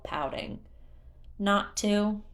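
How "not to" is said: ordinary delivery, nothing marked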